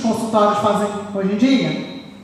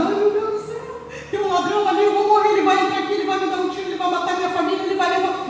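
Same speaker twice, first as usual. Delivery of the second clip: high, fast frantic worrying; desperate panic; 9 semitones higher, faster than usual. Retold or imitated. Preacher mimics an anxious person fearing a burglar, high panicked voice. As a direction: imitate someone spiralling in fear. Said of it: "Você tá tão desesperado".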